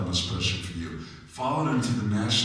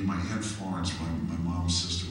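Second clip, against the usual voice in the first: ordinary delivery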